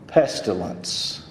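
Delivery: ordinary delivery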